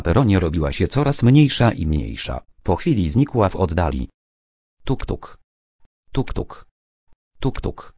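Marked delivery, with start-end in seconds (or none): 4.8-5.4 higher, softer "Tuk, tuk" tapped out like rail joints; voiced, soft "tuk, tuk" ticking along the track; a gentle mimicked train rhythm; train wheels clacking. Stukały koła po torach. 6.1-7.9 "Tuk, tuk" breathed in a whisper, fading off; soft whispered clack of the wheels; whispered train wheels. Acted. Stukały koła po torach.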